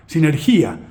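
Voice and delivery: "sinergia" as si-ner-GÍ-a, stressed on the I; Greek pronunciation. Shown as a demonstration. Lecturer having a go at Greek, "sinergia" stressed si-ner-GÍ-a. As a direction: demonstrate "sinergia" with a strong accent on GÍ. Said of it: "se pronuncia así".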